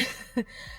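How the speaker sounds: soft unvoiced laugh of disbelief; breathless laugh. Real, not acted. Overwhelmed by a donor's gift, a breathless laugh. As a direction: let out a silent, airy laugh.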